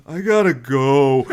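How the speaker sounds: slow, crestfallen mumble; saddest way possible. Retold or imitated. Rejected guy leaving, slow crestfallen exit line. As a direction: deflate as you say it. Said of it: "just in the saddest way possible goes".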